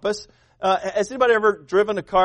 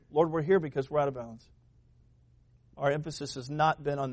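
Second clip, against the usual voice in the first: soft, much quieter pleading prayer; 4 semitones lower, 6 dB quieter than usual. Acted. Family asking God for forgiveness, quiet and humble. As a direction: confess humbly, almost under the breath. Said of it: "go to God and say".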